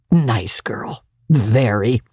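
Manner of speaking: plain reading voice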